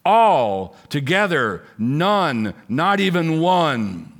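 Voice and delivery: each word drawn out and weighted; slow, solemn emphasis on every word; slow emphatic scripture. Acted. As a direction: lean hard on all together, none, not even one. Preacher repeats the verse emphatically.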